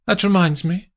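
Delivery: high, sudden thought; high, brisk; Amandine. Acted. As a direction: snap into action. Amandine remembering.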